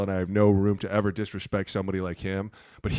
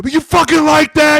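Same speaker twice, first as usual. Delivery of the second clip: much higher, louder excited shout; hyped yell; 12 dB louder than usual. Retold or imitated. Kicker celebrating after a field goal, loud high hype. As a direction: yell it pumped after the kick.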